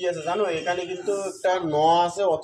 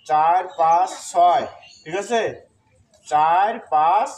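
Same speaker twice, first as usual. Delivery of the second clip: louder, drawn-out counting; slow emphatic count; 4 dB louder, slower than usual. Shown as a demonstration. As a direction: count the beats out slowly and loudly. Counting syllables of the metre.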